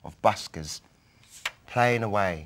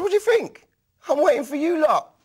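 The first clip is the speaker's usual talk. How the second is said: cheeky reply; 7 dB louder than usual. Retold or imitated. His cheeky reply to Jazzy.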